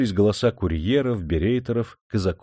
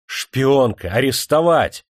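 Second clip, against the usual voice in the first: loud, high cry of indignation; outraged shout; 4 semitones higher, 6 dB louder, slower than usual. A narrator's reading. Adjutant demands the spy's arrest, shouting indignantly. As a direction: shout it beside yourself with outrage. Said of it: "вне себя негодующе закричал".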